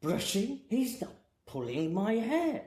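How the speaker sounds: put-on high-pitched, drawn-out French-accented woman; mock French complaint, pitched well up; French client. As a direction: imitate a French lady complaining in accented English. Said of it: "she's saying in French".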